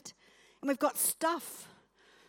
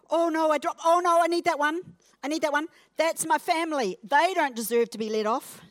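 mock panic; 5 semitones higher, 5 dB louder than usual. Acting clinging to a dropped burden.

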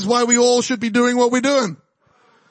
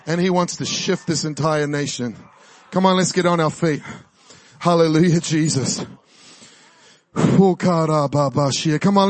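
hushed call to worship; worship leading; 7 semitones lower than usual. Acted. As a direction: draw the room gently into worship. Leads the room into worship.

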